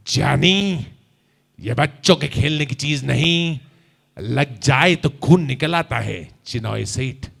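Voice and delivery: low, slow menacing filmi drawl; Raaj Kumar dialogue. Shown as a demonstration. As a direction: threaten with a cool, heavy voice. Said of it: "आप ऐसे ही बोलिएगा". (धीमी, भारी, धमकी भरी राजकुमार आवाज़)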